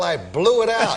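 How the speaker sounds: ordinary talk, nothing marked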